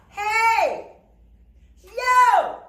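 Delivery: big high open-mouthed holler; loud drawn-out calling; yell across the street. Shown as a demonstration. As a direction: shout it to someone far off. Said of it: "yell across the street".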